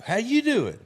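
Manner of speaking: high, loud, chirpy greeting; cheery kid. Retold or imitated. Kid greets the grumpy traveler at the bar, cheery. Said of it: "Joe Cheery, right".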